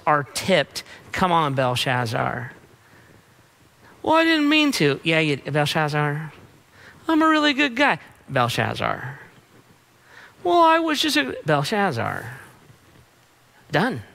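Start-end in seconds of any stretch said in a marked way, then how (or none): 4.0-5.0 high, whiny pleading voice; whiny excuse. Acted. Sinner making excuses to God. 7.1-8.0 high, whiny pleading voice; whiny excuse. Acted. Sinner making excuses to God. 10.4-11.4 high, whiny pleading voice; whiny excuse. Acted. Sinner making excuses to God.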